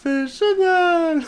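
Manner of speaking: high, light Sim voice; voiced Sim. Sim tells the player about her beak-nose. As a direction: play the Sim talking.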